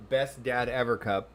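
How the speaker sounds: ordinary delivery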